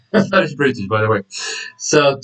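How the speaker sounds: usual speaking voice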